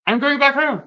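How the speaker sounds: high, loud burst of resolve; resolved declaration. Retold or imitated. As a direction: announce it like a decision finally made. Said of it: "you know, like".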